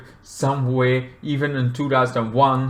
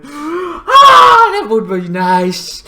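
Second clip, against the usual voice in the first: high loud wail, easing down; exaggerated longing; 12 semitones higher, 7 dB louder, much slower than usual. Vlogger dreaming of living alone, wailing mock longing. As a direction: cry out in mock yearning.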